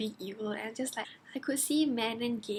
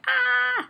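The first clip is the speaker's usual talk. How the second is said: high, loud, sustained "ah"; shocked scream; 14 semitones higher, 10 dB louder than usual. Retold or imitated. Reader reacting to a sudden beheading, shocked scream. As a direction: let out a long mock-horrified scream.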